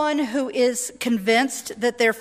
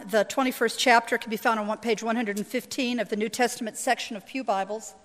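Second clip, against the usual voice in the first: usual speaking voice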